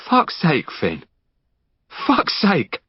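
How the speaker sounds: sharp, high exasperated swearing; fed-up friend snapping at Finn, high and exasperated; exasperated outburst. Acted. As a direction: throw it up in despair at him.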